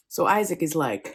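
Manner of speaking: ordinary delivery, nothing marked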